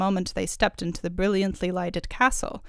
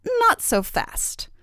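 very high, slow fairy voice; taunting fairy; 14 semitones higher, much slower than usual. A narrator's reading. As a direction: halt him with slow, high-pitched mockery. Fairy stops the king leaving.

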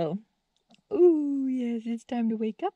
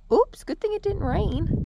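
ordinary talk, nothing marked

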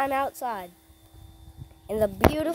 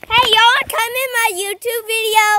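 high, loud, fast call across the yard; 9 semitones higher, 7 dB louder, faster than usual. Real, not acted. Calling to cousins from afar.

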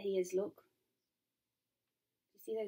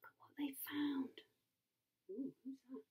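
high near-whisper; hushed wonder; 4 semitones higher, 13 dB quieter than usual. Real, not acted. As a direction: point out the find in soft awe. Hushed aside on the picture.

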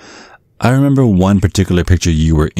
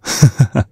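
knowing chuckle; 9 semitones higher than usual. Acted. Dad laughs about what people do.

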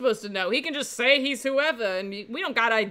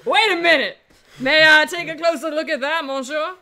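louder and higher, suddenly eager; excited; 7 semitones higher, 13 dB louder than usual. Real, not acted. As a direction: jump on the discovery. Spots the book page, excited.

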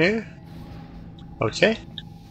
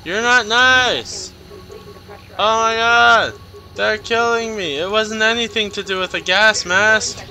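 frustrated yelling; 7 semitones higher than usual. Real, not acted. Gamer yelling at the enemy killing him, frustrated. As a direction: yell at the game as it kills you. Character attacks, player yells.

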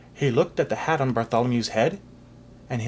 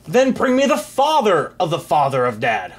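ordering; 4 semitones higher, 5 dB louder than usual. Acted. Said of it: "ordered the king".

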